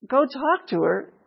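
slow, high-pitched disbelieving question; incredulous echo. Acted. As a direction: repeat it back as if it's crazy. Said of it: "that's crazy".

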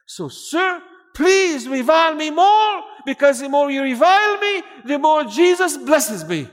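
high, loud, drawn-out begging; fervent shouted plea. Retold or imitated. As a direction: cry it out like an eager convert. Convert joyfully begs for more insults.

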